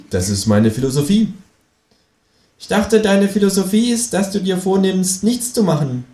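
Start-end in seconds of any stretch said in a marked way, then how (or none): 2.6-6.0 a high, cheeky kangaroo asking. Acted. Kangaroo asks the narrator abseiling from the balcony, high and cheeky. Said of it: "Känguru speaks".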